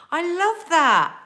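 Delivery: slow, high, loud drawn-out love; delighted. Real, not acted. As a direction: gush over it. Delighted reaction to message.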